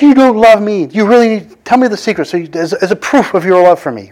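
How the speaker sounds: high, guilt-tripping plea; pleading Delilah. Retold or imitated. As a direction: lay the guilt on thick. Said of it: "she's like".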